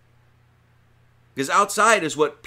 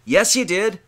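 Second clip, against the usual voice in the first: louder, higher call-out of the excuse; firm rebuke; 5 semitones higher, 6 dB louder than usual. Acted. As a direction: hit back at the sinner's excuse. Preacher rebuts imagined sinner.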